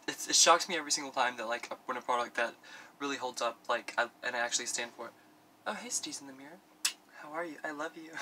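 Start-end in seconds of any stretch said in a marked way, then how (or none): 5.7-8.1 soft, small, affectionate voice; cutesy self-greeting. Pretend. Vlogger greeting self in the mirror, soft affectionate voice. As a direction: coo it quietly, as to a sweetheart.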